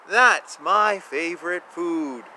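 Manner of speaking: ordinary delivery, nothing marked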